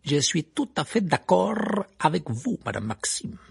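bow and flatter her; fawning Karkaroff. A narrator's reading. Karkaroff s'incline devant Maxime. (Karkaroff flatteur)

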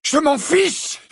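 high-pitched furious yell; shouting. A narrator's reading. Harry shouting back at the portraits, shouting. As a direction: scream it back defiantly. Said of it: "leur cria Harry".

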